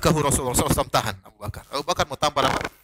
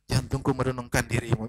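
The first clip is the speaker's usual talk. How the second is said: lower, softer mock-romantic reply; pretend ailing husband; 7 semitones lower, 6 dB quieter than usual. Husband sweet-talks his worried wife, low pretend weakness. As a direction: drop low and quiet like a feigning patient. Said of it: "bersandiwara seakan-akan jantungnya kambuh".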